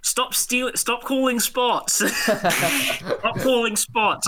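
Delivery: high, drawn-out mock complaint; mock protest. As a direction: call it out slowly and high, half laughing.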